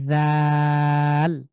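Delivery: touch the teeth and draw out a thin দাল; thin dal. Shown as a demonstration. Teacher demonstrating a thin দাল to the class. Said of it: "জিভটা সামনের দিকে দাঁতের সাথে লাগিয়ে পাতলা করে".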